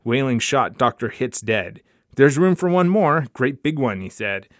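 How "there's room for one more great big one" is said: high, loud call from the gunman; raised-voice, manic announcement; wild outburst. Acted. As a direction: call it out with grim exultation. Gunman calls out after shooting doctor.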